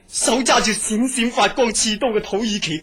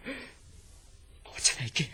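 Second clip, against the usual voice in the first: much softer and slow, whispered; whispered fear; 12 dB quieter, much slower than usual. Acted. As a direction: confess your fear barely aloud. Admits fear to doctor. (好驚咁細聲講)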